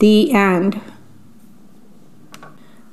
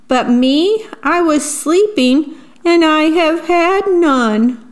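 rising, higher mock whimper; whimpering bear; 6 semitones higher than usual. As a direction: whine like a sulky bear about to cry. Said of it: "he whimpers and he moans".

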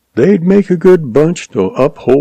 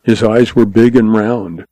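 usual speaking voice

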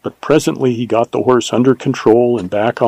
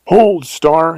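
firm, raised command to a horse; stern call; 5 semitones higher than usual. A narrator's reading. Rider ordering his plunging horse to stop, stern. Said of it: "he called sternly".

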